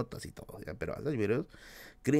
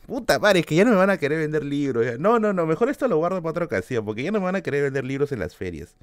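fast, louder flustered backpedal; flustered panic; 4 semitones higher, 4 dB louder, faster than usual. Real, not acted. Worried the sellers will cut him off, fast and flustered. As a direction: rush it out as if caught saying too much. Panics about revealing secret.

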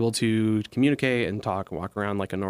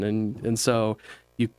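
ordinary talk

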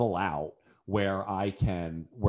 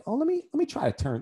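tentative self-talk; 13 semitones higher than usual. Retold or imitated. Himself tentatively trying to turn.

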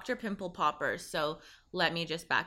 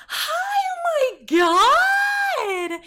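very high, loud, drawn-out squeal; gushing neighbor; 12 dB louder, much slower than usual. Retold or imitated. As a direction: stretch the gush way up and slow. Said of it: "she's like".

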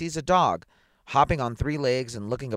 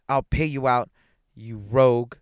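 louder, vengeful threat; threatening; 3 semitones higher, 7 dB louder than usual. A narrator's reading. Bitten man threatening the dog, loud and vengeful. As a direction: hurl it at the rogue. Threat read from drunken face.

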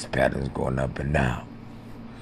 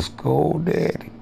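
put-on pleading child; 13 semitones higher, slower than usual.

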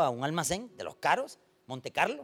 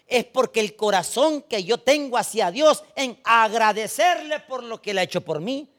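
declaimed louder, slowed down; loud testimony; 5 semitones higher, 8 dB louder than usual. Retold or imitated. Pastor voices the grateful woman to the church, loud. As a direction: proclaim her gratitude to God.

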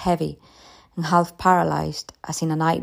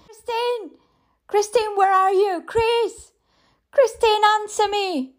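put-on much higher desperate calls for her child; mother searching the house for her daughter; frantic calling. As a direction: call out for her in panic.

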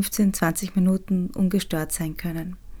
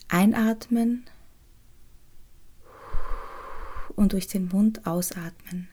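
soothing spa whisper. Acted. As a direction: whisper them gently into calm. Leads the inner child meditation.

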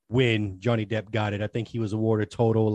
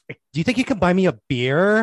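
high, louder mock affected woman; put-on joking-sounding voice; 8 semitones higher, 5 dB louder than usual. Woman asking a stranger for a beer, high affected imitation. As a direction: imitate her affected way of talking, pitched up. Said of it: "joking by the way she was talking".

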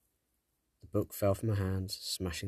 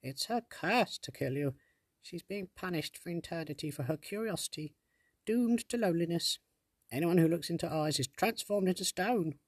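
fairy sprite voice; 10 semitones higher than usual. Acted. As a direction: tell the curse like a tiny fairy. Sprite explains the curse.

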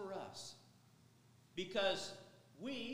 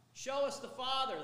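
demanding disciples; 6 semitones higher, 9 dB louder than usual. Acted. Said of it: "they said".

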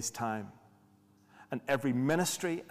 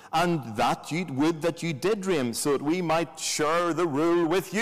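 sarky, raised and loud mock wishing; Paul; 4 semitones higher, 6 dB louder than usual. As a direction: declaim it with heavy sarcasm, higher and louder. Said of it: "You can hear his voice".

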